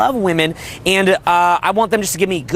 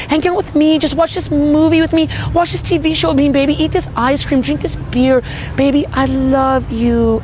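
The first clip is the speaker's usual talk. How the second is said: put-on high, sweet, pleading baby talk, much higher; coaxing girlfriend; 8 semitones higher than usual. Girlfriend coaxing her man to stay home, in high cooing baby talk. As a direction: coo at him like a clingy girlfriend. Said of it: "the girl might even say".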